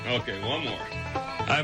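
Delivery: usual speaking voice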